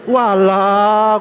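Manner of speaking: loud, high, drawn-out acted surprise; astonished exclamation. Pastor hears the rival is the husband's brother, astonished exclamation. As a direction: exclaim in hammed-up disbelief.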